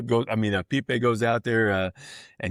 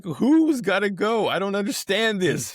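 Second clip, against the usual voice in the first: incredulous Skip; 9 semitones higher than usual. Retold or imitated. Manager pleading with third base umpire, high disbelieving whine. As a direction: stretch the disbelief, pitch way up. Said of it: "He's like".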